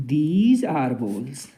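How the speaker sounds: very slow, word by word; slow model sentence. Shown as a demonstration. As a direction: say it slowly so pupils can repeat. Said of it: "तो हम कहेंगे".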